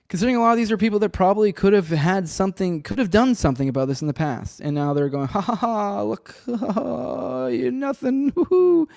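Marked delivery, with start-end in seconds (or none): 5.3-8.9 high-pitched exaggerated gloating cackle; mocking laughter. Politicians jeering at the public, mocking and high-pitched. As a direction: crow it in a high, taunting mock laugh.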